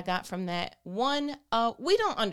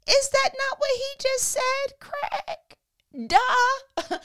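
much higher, sassy put-on voice; mocking disbelief; 4 dB louder, much slower than usual. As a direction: scoff at Craig like he missed the obvious. Mocking a clueless disciple.

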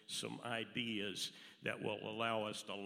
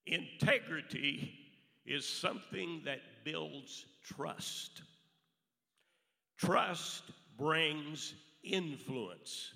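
slow, emphatic; 4 semitones higher, slower than usual. Acted. Slow emphatic sermon point.

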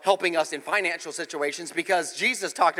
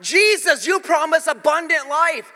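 high, loud, slow pleading to God; crying out to Jesus; impassioned prayer; 7 semitones higher, 4 dB louder than usual. Retold or imitated. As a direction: passionate prayer. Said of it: "I'm like".

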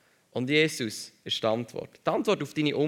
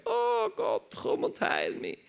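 high, pleading self-abasing cry; prophet crying to God; 10 semitones higher, slower than usual. Retold or imitated. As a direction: beg God like a desperate prophet. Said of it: "Propheten zu Gott schreien". (hoch und flehend, sich klein machend)

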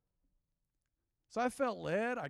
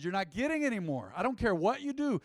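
defiant refusal; 7 semitones higher, 6 dB louder than usual. Retold or imitated. Refusing aggressive beggars at bridge.

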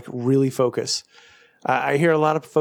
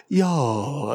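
long, over-careful British "Y'all"; British attempt at y'all. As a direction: imitate Brits trying too hard at y'all. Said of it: "they try really too hard".

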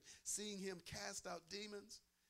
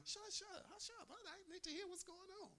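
much higher, hushed irritated shush; annoyed shusher; 13 semitones higher, 6 dB quieter than usual. Retold or imitated. Preacher imitating a concertgoer hushing a clapper, high and hushed. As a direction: scold a noisy neighbour under your breath. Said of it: "somebody's clapping real".

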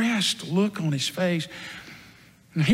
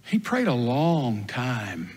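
"long time" stretched ever slower and lower; drawn-out emphasis; 6 semitones lower, 3 dB quieter, much slower than usual. A narrator's reading. Peter recalling Jesus praying for hours, drawn-out emphasis. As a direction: draw out "Real long time" slowly, in a low voice.

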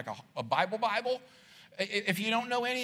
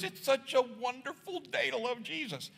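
lightly mocking a sugary church person; a touch of syrupy church talk; mild parody of church-speak; churchy greeting; 5 semitones higher, 5 dB quieter than usual.

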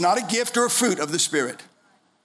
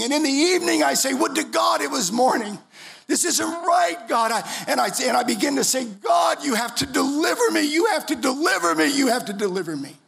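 desperate crying out; 6 semitones higher than usual. Retold or imitated. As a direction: retell the cry as if in the pit again. Prayer retold crying out.

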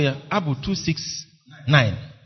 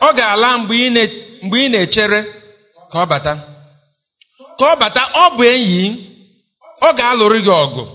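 hymn recital; 4 semitones higher, 6 dB louder, faster than usual. Acted. Stanza two.

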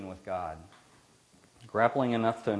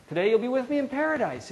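voicing Jesus; 12 semitones higher, 5 dB louder than usual. Jesus speaks to the thief.

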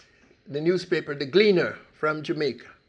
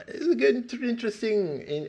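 normal talking voice

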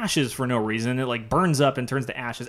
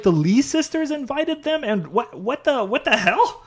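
much higher, baffled and indignant; exasperated disbelief; 8 semitones higher than usual. Retold or imitated. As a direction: throw up your hands at the sisters. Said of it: "I was like".

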